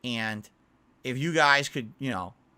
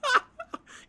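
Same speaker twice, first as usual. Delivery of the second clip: laughing; 3 dB louder than usual. Real, not acted. Laughs again.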